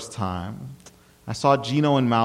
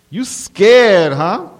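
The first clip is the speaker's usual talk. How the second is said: loud, high-pitched bully's challenge; menacing taunt; 9 semitones higher, 9 dB louder, much slower than usual. A narrator's reading. Bully looming over the frozen narrator, loudly taunting. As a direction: taunt him right up in his face.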